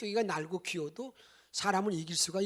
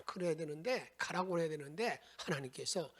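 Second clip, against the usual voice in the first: ordinary delivery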